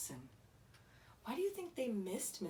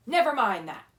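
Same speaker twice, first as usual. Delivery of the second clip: loud, sharp snap; snapped; 4 semitones higher, 12 dB louder, faster than usual. A narrator's reading. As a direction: cut the kids off hard. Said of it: "snapped Missus Swamp".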